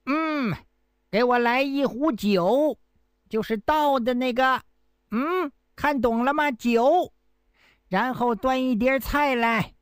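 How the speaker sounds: pretending to be deaf. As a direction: play a fake deaf man making a show of it. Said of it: "阮英装腔作势地说".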